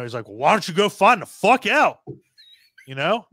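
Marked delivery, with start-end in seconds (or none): 0.3-2.0 angry order. Retold or imitated. Gnome King voiced ordering minion.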